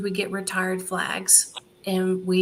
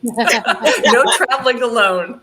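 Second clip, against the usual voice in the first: slow, laughing and much louder; 5 semitones higher, 11 dB louder, slower than usual. Real, not acted. Guest laughing to hosts at her husband's line. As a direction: laugh through the punchline.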